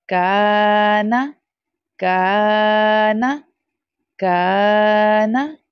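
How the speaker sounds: काना slow, का drawn long; stretched kaa. Shown as a demonstration. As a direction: hold the का, short ना. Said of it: "stretch करके पढ़ना होता है". (काना में का खींच कर)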